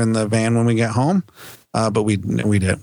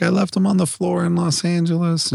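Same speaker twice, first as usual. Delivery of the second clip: put-on upset child; 6 semitones higher than usual. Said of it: "she started bawling".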